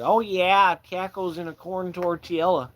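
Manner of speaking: high-pitched, hammed-up Midwest accent. Shown as a demonstration. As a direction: put on a folksy Midwest accent. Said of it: "I can do a Midwest accent".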